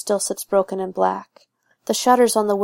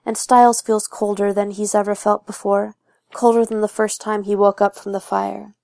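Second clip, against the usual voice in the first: plain reading voice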